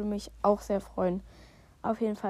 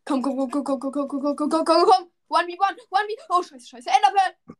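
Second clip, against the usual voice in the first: panicking gamer; 4 semitones higher, 7 dB louder than usual. Real, not acted. Frantic duel, urgent.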